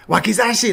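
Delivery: high, loud blurted Wakizashi!; shouted Japanese word. Retold or imitated. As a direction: yell Wakizashi! as the only phrase you know. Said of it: "I'm just like".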